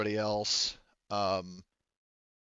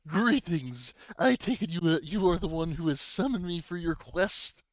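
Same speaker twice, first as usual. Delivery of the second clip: higher-pitched, stately role voice; grand NPC greeting; 5 semitones higher than usual. Acted. Game master as the summoned visitor, grand and formal.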